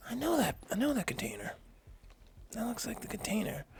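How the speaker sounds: high, partly whispered realization; high-voiced knowing aside; retold dawning recognition. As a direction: reenact spotting it, pitched well up. Acted dawning recognition of his container.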